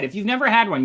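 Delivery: plain reading voice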